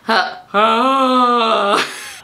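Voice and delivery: harsh rising fry scream try; half-laughing attempt at a fry scream. Real, not acted. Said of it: "How do you do the fry screaming?".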